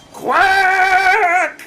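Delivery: loud high duck shriek; shouted quack. Acted. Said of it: "It's all caps".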